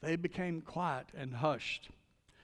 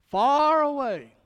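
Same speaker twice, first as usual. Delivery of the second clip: "far away" stretched slow, much higher and louder; stress on far away; 9 semitones higher, 11 dB louder, slower than usual. Acted. Preacher reading God's words to Paul, "far away" drawn out loud. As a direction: lean hard and long on "far away".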